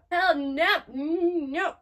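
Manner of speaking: emphatic refusal. Real, not acted. Viewer rejects the demon's offer to Rengoku, emphatic refusal. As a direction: refuse it point-blank at full voice.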